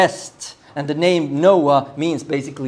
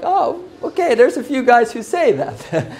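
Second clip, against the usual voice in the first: retold pleasant realization; 7 semitones higher than usual. Preacher retelling his find in the commentaries, mildly surprised. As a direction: act out finding out you aren't alone, lightly, pitched well up.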